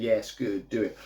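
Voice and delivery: low, soft sinister urging; Emperor from Star Wars. Retold or imitated. Witch egging on the choking, lightly imitated sinister overlord. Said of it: "Very Emperor-like from Star Wars".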